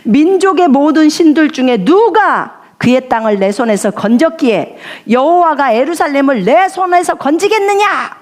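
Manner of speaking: high, arrogant mock boast; boastful enemy. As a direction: brag like a conqueror sure of victory. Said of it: "그가 말하기를". (높고 거만하게 뽐내는 적장)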